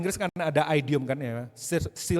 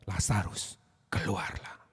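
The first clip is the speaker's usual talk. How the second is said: soft unvoiced whisper, lower; whispered command; 8 semitones lower, 5 dB quieter, slower than usual. Preacher voicing Jesus calling Lazarus from the tomb, whispered. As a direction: breathe the name like a secret.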